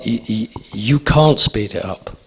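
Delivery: ordinary talk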